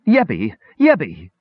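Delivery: anguished cry of her name. Acted. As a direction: call out to her in distress.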